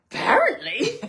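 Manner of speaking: loud, high wry reply. Retold or imitated. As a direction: toss it off, mock-surprised. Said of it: "I was like".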